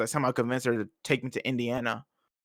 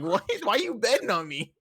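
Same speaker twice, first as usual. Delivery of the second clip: higher-pitched retold disbelief; incredulous son; 6 semitones higher than usual. Son questioning mom's bet on him, incredulous. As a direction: act out a baffled protest.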